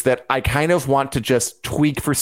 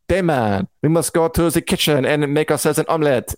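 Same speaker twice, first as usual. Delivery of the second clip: playful put-on French accent; hammed-up, odd French-flavoured accent; Mysaria's accent. Host playing at talking like the actress's character, mock French accent. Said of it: "I do kind of like talking in it".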